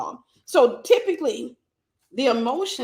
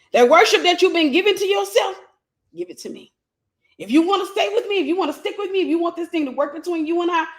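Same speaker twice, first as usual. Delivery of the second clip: high, forceful acted demand; preacher acting out a controlling lover's demand; demanding narcissist; 7 semitones higher, 5 dB louder, faster than usual. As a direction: press an ultimatum in a raised voice. Acts out narcissist demanding worship.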